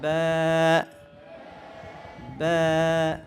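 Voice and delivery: ব্যা with a light, fronted "ae" vowel, held for one alif; low, level, drawn-out ব্যা; thin ব্যা. Shown as a demonstration. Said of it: "এটা পাতলা উচ্চারণ করাবো".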